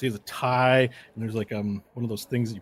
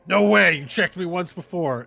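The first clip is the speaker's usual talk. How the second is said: raised, loud outburst that eases; indignant protest; 6 semitones higher, 4 dB louder than usual. Acted. Competitor protests second check, indignant. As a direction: burst out in objection.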